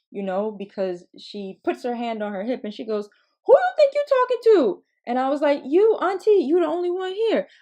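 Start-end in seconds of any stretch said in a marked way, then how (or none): 3.5-4.8 loud, high mock-outraged challenge; indignant auntie. Aunt challenging a child's sassy answer, loud mock indignation. 5.7-7.5 put-on high-pitched, emphatic kid answer; innocent child. Child answering auntie's rhetorical question, high innocent insistence.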